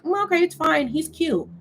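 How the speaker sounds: high-pitched mock swooning; smitten excuser. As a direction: imitate a woman brushing it off for a crush. Mimics women excusing the man.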